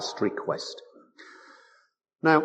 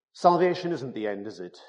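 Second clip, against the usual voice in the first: usual speaking voice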